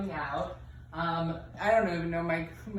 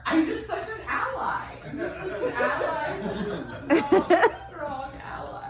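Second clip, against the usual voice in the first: overeager ally; 8 semitones higher, 5 dB louder than usual. Retold or imitated.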